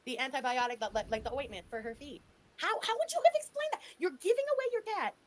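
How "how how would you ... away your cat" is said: high, loud disbelieving demand; incredulous outburst. Real, not acted. Arguing with Chantal, a high loud incredulous shout.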